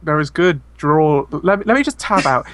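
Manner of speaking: ordinary talk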